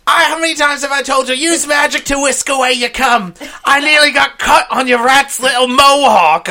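Ron's mum nagging about the mess, high shouted scold; scolding mother. Acted. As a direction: tear into him like a fed-up mum.

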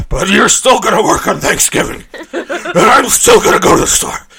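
put-on rising ranting old boomer; boomer villain rant. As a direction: build from taunting to yelling. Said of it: "did you like my boomer impression".

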